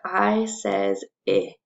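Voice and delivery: slow "I says I" with short i; the letter "I" named, then a short i sound. Shown as a demonstration. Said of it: "And remember".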